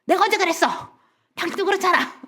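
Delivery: high-pitched mock protest; defensive retort. Mother defending herself against father, high defensive retort. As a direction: imitate someone throwing blame straight back. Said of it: "방어하셨어요".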